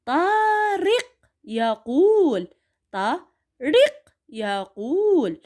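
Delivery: طارق يقول read very slowly, syllable by syllable; slow model reading. Shown as a demonstration. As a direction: read it slowly for the children to repeat. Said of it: "راح اقروا وياي".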